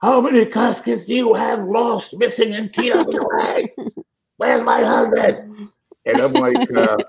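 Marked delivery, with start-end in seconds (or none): 0.0-5.7 high, shrill mock hollering widow; hammed-up frantic widow voice; mock hollering wife. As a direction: play the frantic widow, pitched way up. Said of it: "she's now hollering".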